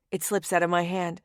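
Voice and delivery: usual speaking voice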